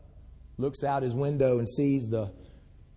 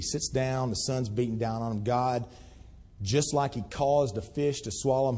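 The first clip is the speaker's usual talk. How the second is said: ordinary talk, nothing marked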